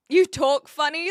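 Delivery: loud, teasing quote of a chat troll; jeering imitation of a chat comment; mock taunt. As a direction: fling it like a rude viewer would. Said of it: "that's another one".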